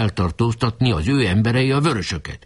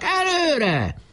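high, rallying cry; shouting. A narrator's reading. Boys urging each other forward, high shout. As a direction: urge the troops on in a high call. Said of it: "kiáltották".

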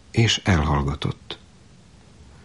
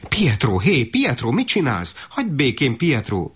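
high, urgent telling-off; mother's alarmed scolding, pitched up; 6 semitones higher than usual. A narrator's reading. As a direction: rebuke a meddling child to make him stop. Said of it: "pirított rá az anyjuk".

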